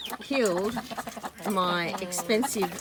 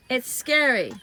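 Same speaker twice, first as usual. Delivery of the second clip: normal talking voice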